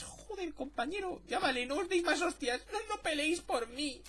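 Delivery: lifted, pleading voice begging them to stop; high plea to quit fighting; high mock plea. Gamer mocking squadmates as a high, pleading voice.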